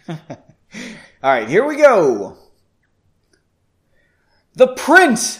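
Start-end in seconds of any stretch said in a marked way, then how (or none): 4.6-5.4 high, loud scoffing; mocking mage. Lead mage scoffs at the prince's claim, high and loud. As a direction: sneer at the very idea of a prince. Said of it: "the lead mage laughed".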